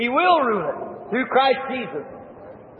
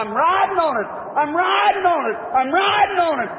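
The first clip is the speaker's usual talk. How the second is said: joyful scream; 6 semitones higher than usual. Retold or imitated. Paul shouts riding the log down the river, jubilant yell. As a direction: shout it out in triumph, higher. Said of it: "screaming with all his voice".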